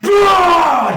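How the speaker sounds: very high, very loud roaring shout; a towering beast bellowing to silence the arena; monster roar. Acted. Tyranitar roaring at the arena crowd before slamming his fist, full shout.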